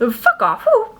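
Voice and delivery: very high tic yelp breaking into a laugh; laughing vocal tic. Real, not acted.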